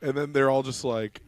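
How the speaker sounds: usual speaking voice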